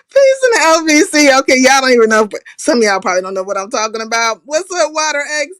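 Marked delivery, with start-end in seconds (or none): 0.1-1.3 loud, bright call to the chat; big shout-out hello; loud greeting. Real, not acted. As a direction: holler the greeting. Signature greeting, louder.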